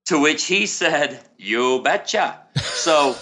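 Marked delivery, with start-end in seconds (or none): 1.4-2.4 slow, lightly imitated Norwegian accent. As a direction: draw it out with a hint of Norwegian. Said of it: "in his thick Norwegian accent".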